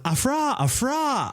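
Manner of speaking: high, garbled panic cries; mock freak-out. People panicking over a kitchen fire, mock panic. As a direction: babble in exaggerated alarm. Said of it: "people are freaking out".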